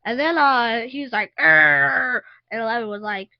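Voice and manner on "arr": loud imitated "Arr"; monster growl. Child retelling the finale fight, loud mock monster growl.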